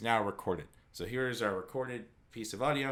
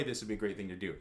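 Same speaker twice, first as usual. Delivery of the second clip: usual speaking voice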